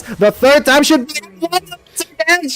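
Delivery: high, loud hype call; shouting caster. Real, not acted. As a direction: scream the clutch play. Caster shouting clutch play.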